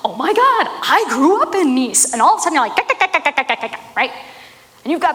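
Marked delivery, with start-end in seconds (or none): 0.0-2.1 higher-pitched, gushing delight at a shared hometown; excited recognition. Acted. Stranger at Starbucks meets someone from their own town, excited recognition. Acted delighted reply of fellow townsperson. 2.8-3.9 rapid-fire nonsense yakking noises; imitated chatter. Two new friends from Nice gabbing away, imitated chatter.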